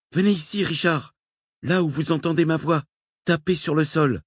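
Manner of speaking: appel plus aigu, voix tendue. A narrator's reading. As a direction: guider Richard à travers la trappe. Crie à Richard à travers la trappe. (Nicole appelle Richard à travers le sol, voix aiguë)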